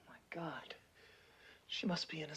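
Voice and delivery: real awed whisper. Real, not acted. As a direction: breathe the words out, no voice. Trapped rescuer in tight hole.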